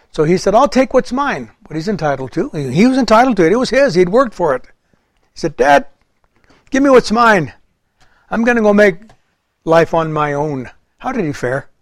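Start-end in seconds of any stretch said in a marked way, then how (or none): 0.5-1.5 demanding son. Retold or imitated. Prodigal demanding inheritance. 5.6-10.7 higher, insistent son demanding his share. Retold or imitated. Prodigal demands from father.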